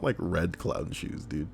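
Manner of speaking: normal talking voice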